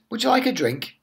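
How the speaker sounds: mummy's lifted hostess voice; bright mummy voice. Acted. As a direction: sing it out like a friendly mum. Mummy offers tiger drink.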